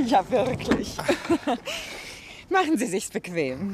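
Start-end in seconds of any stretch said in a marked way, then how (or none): 1.0-2.4 a rising bright laugh. Acted. Mrs Prest laughs at meeting Joe, rising laugh.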